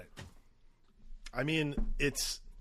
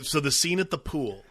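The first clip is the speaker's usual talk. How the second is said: ordinary talk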